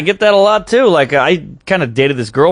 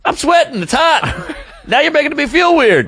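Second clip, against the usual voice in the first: very high, comic defensive whine; mock-flustered self-defense; flustered protest; 10 semitones higher than usual. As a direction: protest in a high voice, breaking into a laugh. Retelling his flustered defensive reply.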